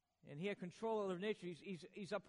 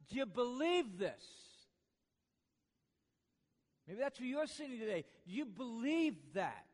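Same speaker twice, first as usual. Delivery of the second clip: loud, raised, emphatic sermon delivery; full-force preaching; 5 semitones higher, 5 dB louder than usual. Real, not acted. Preacher proclaiming resurrection to the congregation, forceful preaching. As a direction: proclaim it with weighty, drawn-out key phrases.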